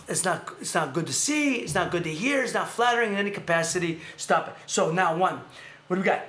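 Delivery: ordinary delivery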